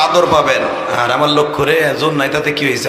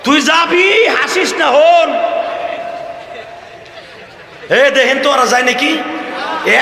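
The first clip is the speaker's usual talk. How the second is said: high, carrying shout from the pulpit; shouted scolding; 14 semitones higher than usual. Real, not acted. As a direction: call out and tell off those leaving. Scolds people leaving from the stage. (মঞ্চ থেকে উঁচু গলায় ধমক)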